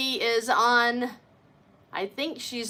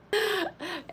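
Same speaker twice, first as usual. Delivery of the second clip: light high laugh; a quick happy giggle; delighted laugh; 10 semitones higher, 5 dB quieter than usual. Real, not acted. At [name]'s surprise location, quick high giggle.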